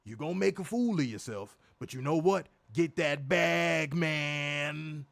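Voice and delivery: slow, drawn-out Southern advice; lightly voiced old-head counsel; Booker T. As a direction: drawl it like a veteran giving business advice. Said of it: "picture Booker T saying that shit".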